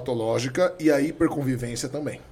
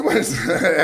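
laughing retort; 2 semitones higher, 7 dB louder than usual. Real, not acted. Laughing at friend's complaint.

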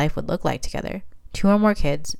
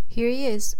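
higher-pitched, mildly excited announcement; 7 semitones higher, slower than usual. A narrator's reading. Doctor handing mother her newborn, lightly excited. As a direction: present the newborn with raised pitch. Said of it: "Addison said excitedly".

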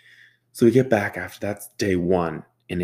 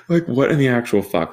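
incredulous exclaim; 5 semitones higher, 5 dB louder than usual. Real, not acted. Exasperated punchline.

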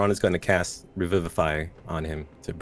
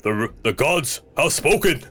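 booming triumphant shout, higher and louder; yelling; 8 semitones higher, 5 dB louder than usual. Acted. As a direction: cry it out to the whole hall. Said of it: "Ron yells out".

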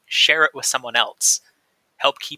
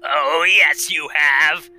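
loud, high snarl; 3 semitones higher, 8 dB louder, much slower than usual. A narrator's reading. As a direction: spit it out like a pouncing beast. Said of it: "snarled and said".

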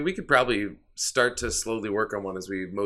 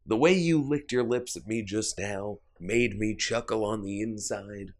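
soft, slow and tender; talking to a dog; 2 semitones lower, 4 dB quieter than usual. Real, not acted. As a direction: coo gently at the dog.

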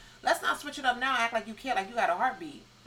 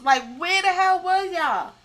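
exasperated outburst; 8 semitones higher, 7 dB louder, slower than usual. Real, not acted. Angry rant at critics.

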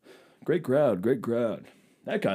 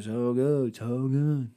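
mock moan; 2 semitones higher, much slower than usual. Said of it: "You kept saying".

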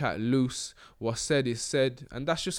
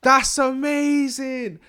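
much higher, louder and slow; amazed; 13 semitones higher, 7 dB louder, much slower than usual. Real, not acted. Marvelling to his co-host that the phone knows, high and drawn out. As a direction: stretch it out in awe.

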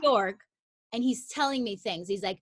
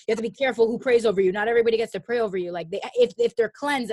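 fast, louder stern dad warning; dad's warning; 7 dB louder, faster than usual. Retold or imitated. Dad warning daughter about who prays over her, fast and louder. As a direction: rattle off dad's worried rule. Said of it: "my dad would be like".

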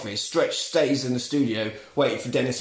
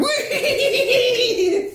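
very high cackling squeal of delight; gleeful old man's whoop; 3 dB louder than usual. Retold or imitated. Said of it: "the old guy from the shop's going".

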